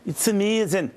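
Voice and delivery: high laughing Scottish accent put on; mock Scottish accent. Host teasing himself after Scotland clip, mock Scottish accent. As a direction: slip into a jokey Scottish accent while laughing. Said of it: "don't do your Scottish accent".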